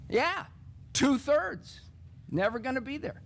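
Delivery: lightly voiced young student, sure of herself. [name] names two-thirds to the teacher, breezy.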